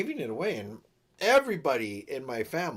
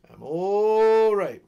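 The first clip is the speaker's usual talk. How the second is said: long, high, loud I'm all right, sung out; drawn-out call; 6 semitones higher, 10 dB louder, much slower than usual. Real, not acted. Untangling the scarf, calling out loud and drawn out. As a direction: stretch it out loud and high to reassure.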